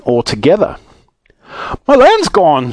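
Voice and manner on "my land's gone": high, louder shriek of dismay. A narrator's reading. Saucepan man finding his land missing, shrieking. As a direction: cry out in horrified shock. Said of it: "shrieked the saucepan man".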